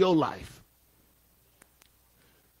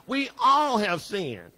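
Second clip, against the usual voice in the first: normal talking voice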